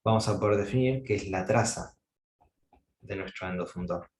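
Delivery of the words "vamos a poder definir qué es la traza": ordinary talk, nothing marked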